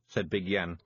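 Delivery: usual speaking voice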